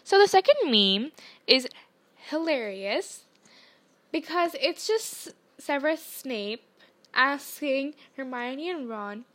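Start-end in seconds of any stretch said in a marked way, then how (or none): none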